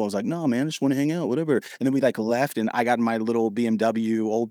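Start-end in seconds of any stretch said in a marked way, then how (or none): 0.2-1.5 playing it cool. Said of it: "I literally played it cool".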